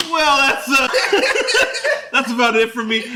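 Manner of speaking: high, loud, breaking into laughter; laughing awkward exit. Retold or imitated. Man excuses himself from the meeting, laughing awkwardly. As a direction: bail out brightly through laughter.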